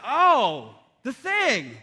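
loud, drawn-out "oh", then "the thing" swooping up and down; hammed-up dawning recognition; mock realization. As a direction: play along with exaggerated sudden understanding. Dad plays along with sleepwalking son.

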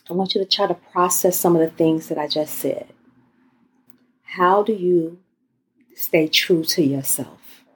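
guided meditation. Acted. Leads guided breathing exercise.

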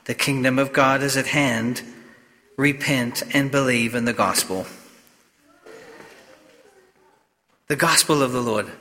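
solemn Gospel proclamation in a reverberant church; cathedral echo. Real, not acted. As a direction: read it like a priest at Mass, unhurried. Priest proclaims the Gospel at Mass.

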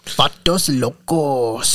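put-on slow, lightly done Chicano accent; a mild Chicano accent, drawn out; a touch of Chicano accent, slow. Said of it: "Chicano accent".

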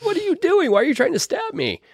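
very high-pitched imitated protest; mock alarm. Podcaster acting Casey dodging Freddy's knife, high mock alarm. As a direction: squeal it like a panicked comedy victim.

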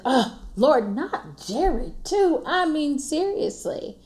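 high, drawn-out mock despair; exasperated Oh Lord. Retelling her dismay at news about Jerry. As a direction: play up the letdown with a long high groan. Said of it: "And I was like".

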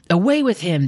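high, forceful crowd yell. A narrator's reading. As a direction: throw it out like an angry mob. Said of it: "they yelled".

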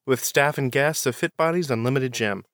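upbeat, punched podcast intro read; show intro announcer. Acted. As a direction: announce the show, lifting the opening words. Reads the show's introduction.

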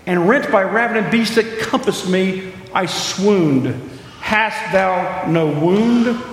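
intoned verse, reverent; poem recitation. Acted. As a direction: read it like a sacred poem. Reciting Carmichael poem aloud.

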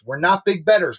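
usual speaking voice

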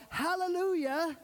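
high, ringing cry of praise. Retold or imitated. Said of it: "they're crying out".

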